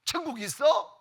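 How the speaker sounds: loud, taunting challenge to a pastor; mock jeering. Communist taunts the pastor about heaven, jeering. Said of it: "막 조롱하면서".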